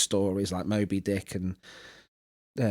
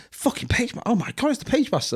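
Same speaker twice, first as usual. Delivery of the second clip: high, breathy thrill at the cover; mock starstruck delight at the gift; excited gasp; 13 semitones higher than usual. Unwrapping a present, thrilled by the cover.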